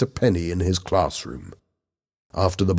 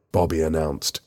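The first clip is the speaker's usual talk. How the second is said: usual speaking voice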